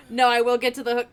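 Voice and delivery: usual speaking voice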